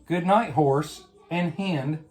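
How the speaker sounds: ordinary delivery, nothing marked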